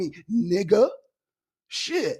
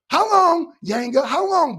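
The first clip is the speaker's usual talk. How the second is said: high, loud, demanding how long; loud mocking challenge to the crybaby; exasperated shout; 7 semitones higher, 7 dB louder than usual. Real, not acted. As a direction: yell it at the chat in disbelief. Calling out the crybaby, heated.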